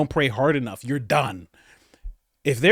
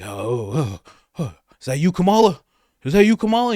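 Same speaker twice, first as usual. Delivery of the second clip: mock Biden voice; 4 dB louder than usual. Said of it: "and he's like".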